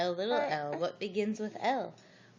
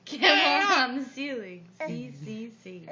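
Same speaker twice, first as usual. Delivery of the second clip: louder, laughing through the line; 3 semitones higher, 8 dB louder than usual. Real, not acted. Reading to a child, laughing through the line. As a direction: giggle your way through it.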